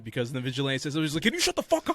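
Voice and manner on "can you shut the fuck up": much higher hammed-up put-on voice. Quoting Vigilante telling Peacemaker to shut up, in a high mock voice. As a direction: fire it off in a silly high voice.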